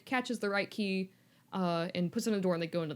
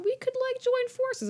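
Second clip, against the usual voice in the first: high wheedling Voldemort; slower than usual. Retold or imitated. Voldemort tempts Harry.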